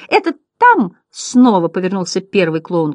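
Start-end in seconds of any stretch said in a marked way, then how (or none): 0.1-1.0 high, loud clownish announcement; clown butterfly. A narrator's reading. First clown tells the company, high comic voice. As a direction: squeal it like a little clown.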